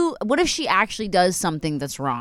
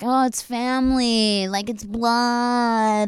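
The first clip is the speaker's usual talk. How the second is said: drawn-out, higher singsong mockery; mocking platitude; 4 semitones higher, much slower than usual. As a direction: stretch the words like preachy relatives. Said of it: "people are always like".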